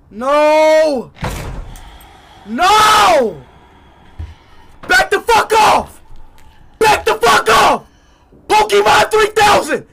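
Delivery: high-pitched shouts at an attacker; frantic shouting. Real, not acted. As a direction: yell to drive it away. Fending off attacker in game.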